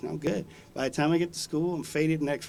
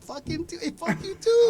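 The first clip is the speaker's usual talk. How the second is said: mock shouting. Kid cussing back at juvenile hall teachers, mock shouting.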